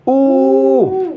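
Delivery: OU held long, high and even; sound demo. Shown as a demonstration. Teacher models the syllable for class, OU drawn out high. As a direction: sustain OU as one pure vowel. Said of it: "et on la prononce".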